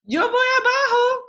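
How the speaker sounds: very high, loud happy yell; shouting. A narrator's reading. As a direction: yell it out with glee. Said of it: "gritó Fred".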